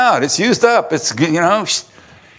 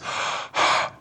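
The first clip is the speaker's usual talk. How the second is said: breath intake; 8 dB quieter than usual. Shown as a demonstration. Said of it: "Pneumos, like pneumonia, your lungs".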